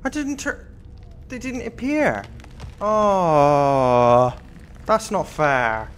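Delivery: loud slow complaining groan; frustrated wail. Real, not acted. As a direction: moan loudly at the game. Killed unfairly in game.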